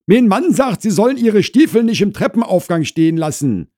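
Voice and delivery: high, loud housewife voice; Frau Krause relaying. Acted. Wife relays husband's boot complaint to visitor, high and insistent. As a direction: pass on the order loudly.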